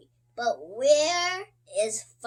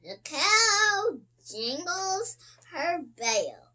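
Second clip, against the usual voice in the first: ordinary delivery, nothing marked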